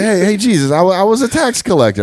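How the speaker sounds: high-pitched nervous tax collector; high comic pleading voice. Pretend. Jokey tax collector pleading with Jesus.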